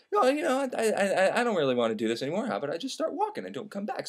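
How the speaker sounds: soft, halting and unsure suggestion; hesitant mumble. Retold or imitated. Candide timidly suggests leaving the army, hesitant mumble. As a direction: trail down quietly, stammering a little.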